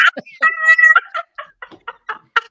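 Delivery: loud high burst of laughter; real wild cackle; high cackling laugh. Real, not acted. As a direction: cackle at the punchline. Huge laugh.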